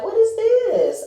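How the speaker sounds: high, slow, delighted what is this; squealing in delighted surprise; amazed. Retold or imitated. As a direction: gasp it out in happy disbelief. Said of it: "I'm like".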